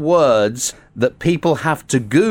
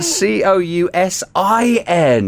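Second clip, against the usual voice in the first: slow, firm letter by letter; spelling it out; 7 semitones higher, slower than usual. Shown as a demonstration. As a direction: correct him, spelling slowly.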